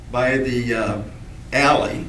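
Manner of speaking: ordinary delivery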